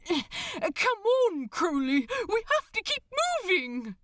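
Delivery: high, loud, urgent cry; disbelieving exclamation. Acted. Aziraphale dragging a lagging Crowley up the deck, high loud exclamation. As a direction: yell at him to keep moving, incredulous. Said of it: "he exclaimed in disbelief".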